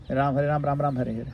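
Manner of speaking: each "Ram" closed on m, no final a; syllables eaten up. Shown as a demonstration. Teacher demonstrating the shortened chant, final vowels dropped. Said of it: "not Ram Ram".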